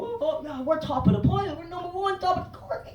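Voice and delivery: high-pitched mock bragging; boastful humans. Comedian mocks humans boasting of their intelligence, high and smug. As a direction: crow in a high, silly voice.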